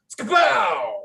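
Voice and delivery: shouted Skapow, high and loud; booming sound effect. Acted. Narrator booms the cannonball impact, shouting. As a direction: blast it out like a cannon hit. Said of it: "performed by the voice of Skapow".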